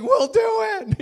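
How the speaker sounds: very high, loud mock-terrified squeal; frantic obedience. Students answering raging chancellor, hammed-up panicked squeal. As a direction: promise like a petrified student.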